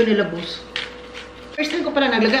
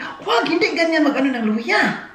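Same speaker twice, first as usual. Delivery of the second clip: scolding grandma; 6 semitones higher than usual. Retold or imitated.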